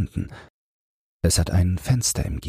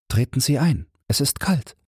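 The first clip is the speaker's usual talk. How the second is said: a higher, lightly voiced young woman; young woman inviting him in; 9 semitones higher than usual. A narrator's reading. Woman invites the hesitant stranger inside, light and higher. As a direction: coax him in from the doorway, voice lifted. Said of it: "sagt die Frau".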